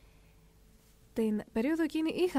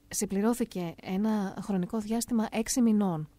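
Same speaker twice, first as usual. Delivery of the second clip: ordinary talk, nothing marked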